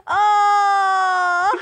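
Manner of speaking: loud, high delighted cry; excited shout. Real, not acted. Delighted at giant duck.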